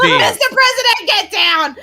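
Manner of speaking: high-pitched bodyguard yell in jest; mock warning shout. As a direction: cry out a hammed-up warning.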